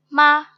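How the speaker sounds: fourth-tone मा, brief and emphatic; falling fourth tone. Shown as a demonstration. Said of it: "falling tone".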